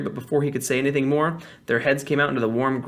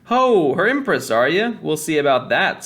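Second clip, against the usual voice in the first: loud, high mocking challenge; jeering heckler; 3 semitones higher, 4 dB louder, slower than usual. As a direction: call it out from a jeering crowd. Crowd heckler mocks the witch.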